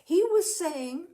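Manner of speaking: put-on loud and high, calling to a half-deaf old man. A narrator's reading. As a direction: raise your voice so the old father hears. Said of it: "would shout".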